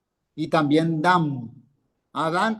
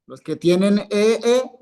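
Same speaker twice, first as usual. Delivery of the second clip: ordinary delivery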